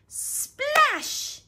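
high, loud splash; exclaimed splash. A narrator's reading. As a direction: burst out with the sound word. Story's sound word acted out.

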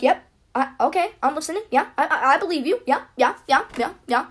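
high, repetitive yeahs mimicking a listener; nodding vice principal. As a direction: parody someone over-agreeing while nodding. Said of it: "she would be, like, nodding her".

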